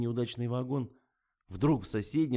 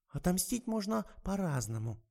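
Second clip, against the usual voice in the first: serious; 10 semitones higher than usual. A narrator's reading. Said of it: "серьёзно, словно умудрённая опытом Матрона".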